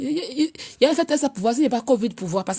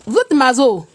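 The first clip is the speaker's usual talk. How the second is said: emphatic teasing; 2 semitones higher, 5 dB louder, slower than usual. Real, not acted. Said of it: "Quand je dis que vous êtes mazo".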